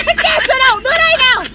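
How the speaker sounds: put-on a greedy girl snatching the drink; eager demand. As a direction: hand it over, eager. Girl eager for milk tea.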